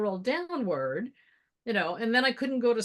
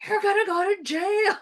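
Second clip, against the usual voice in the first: much higher, far-off alarm; mock panic; 10 semitones higher, 5 dB louder than usual. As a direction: blurt the worst case. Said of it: "I was going like".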